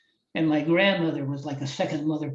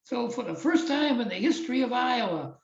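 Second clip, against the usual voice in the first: imitating the announcer; 6 semitones higher than usual. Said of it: "he says".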